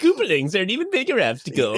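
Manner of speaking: high, fast indignant neighbour; mock outraged neighbour; Nextdoor poster voice. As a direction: complain like an annoyed Nextdoor poster. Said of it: "someone on Nextdoor saying it".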